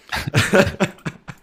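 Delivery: high, airy chuckle; amused laugh. Real, not acted. Host enjoys Aaron's impression, amused laugh. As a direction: laugh off the joke.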